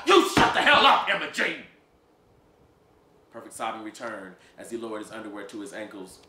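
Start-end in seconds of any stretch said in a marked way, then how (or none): 0.1-1.6 angry snap. Acted. Gus silences her.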